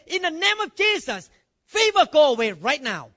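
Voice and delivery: loud command at the fever; commanding shout. Shown as a demonstration. Pastor in his son's room, ordering the fever out, shouting. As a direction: bark the command with full authority. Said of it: "you don't need to even yell".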